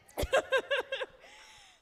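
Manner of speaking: high burst of squealing laughter; a loud squealing laugh of delight; delighted shrieking laugh. Real, not acted. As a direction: let out a loud gleeful laugh. Crowd cheers, host delighted.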